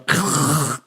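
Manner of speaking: exaggerated guttural throat-scraping growl; mock harsh German "ch"; fake German noise. Shown as a demonstration. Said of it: "That's a fake German".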